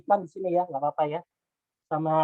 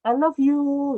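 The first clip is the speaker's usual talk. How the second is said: high, loud, drawn-out mock confession; imitated loving tone; 9 semitones higher, 5 dB louder, much slower than usual. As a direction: copy a sweet lover's lilt, pitched up. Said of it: "Coach Nia tadi". (menirukan nada penuh cinta)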